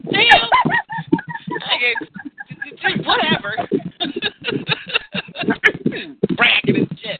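high and giggling through words; giggly stoned laughter between lines; stoner. Real, not acted.

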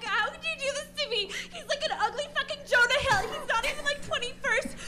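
high, loud, hammed-up wail; whiny best friend. Best friend in the bathroom corner, hammed-up high whine. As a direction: play the betrayed friend pleading through laughs.

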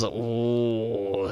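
a low, drawn-out ooh in a friend's voice; Johnny Kim impression. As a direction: lightly imitate Johnny Kim's ooh. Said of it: "as Johnny Kim would say".

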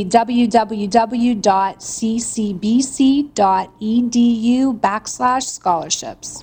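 spelling it out slowly; slow dictation. Real, not acted. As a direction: read the web address letter by letter. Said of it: "give me the website address so kids can look up".

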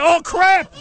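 high, loud yelp of panic; panicked yell. Retold or imitated. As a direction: blurt it out in alarm. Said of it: "I said".

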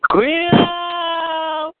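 long high stretched-out hype call; drawn-out call. Acted. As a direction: stretch it out like a ring announcer. Host calls out the warning. (grito largo y agudo de presentador)

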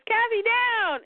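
storyteller's hammed-up alarm; acted warning call. Hero calls out danger in story.

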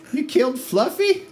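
very high, put-on voice, rising question; aghast halfling woman. Halfling woman learning her bird is dead, high outraged voice. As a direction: squeal it in mock outrage. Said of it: "a halfling woman".

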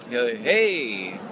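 retold uncertain greeting; hesitant reply. He answers a stranger yelling at him, hesitant reply. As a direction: answer the call warily, drawing out hey. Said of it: "And I'm like".

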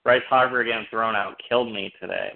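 ordinary talk, nothing marked

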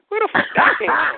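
high, incredulous re-enacted outburst; shocked outburst. Storyteller replays his reaction to being asked about women, shocked.

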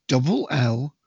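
ordinary talk

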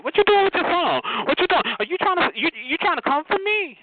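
mocking challenge. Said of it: "so I told her".

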